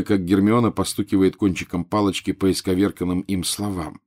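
usual speaking voice

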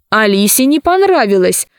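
usual speaking voice

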